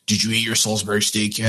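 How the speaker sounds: a hint of a gruff father; deep rugged voice. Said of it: "deep voice with the baby".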